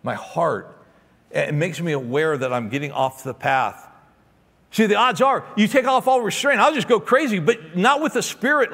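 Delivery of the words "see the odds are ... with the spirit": preaching at full force. Real, not acted. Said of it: "preacher raising his voice".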